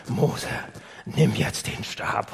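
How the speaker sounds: grave divine order; voice of God. Retold or imitated. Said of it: "Und Gott sagt". (Gott befiehlt Mose, tief und langsam)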